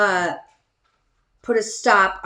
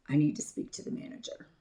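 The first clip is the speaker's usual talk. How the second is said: soft, low, quick controlled request; very calm; 4 semitones lower, 10 dB quieter, much faster than usual. Retold or imitated. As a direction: keep it hushed and tightly composed. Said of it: "I'm talking very calm".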